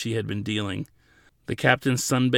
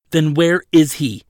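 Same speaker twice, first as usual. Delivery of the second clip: loud, higher demanding question; forceful demand; 5 semitones higher, 11 dB louder than usual. A narrator's reading. Counsel pressing attendant about missing client, loud and forceful. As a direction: confront them, insisting on an answer.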